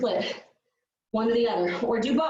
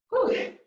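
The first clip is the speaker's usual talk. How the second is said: breathy laugh and whew; 11 semitones higher than usual. Real, not acted. Laughing breathless during workout.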